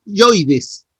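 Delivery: yoides said slowly and clearly, stress on the o; teacher modelling yoides; correct pronunciation. Shown as a demonstration. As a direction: spell out yoides as the correct form. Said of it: "Entonces se dice".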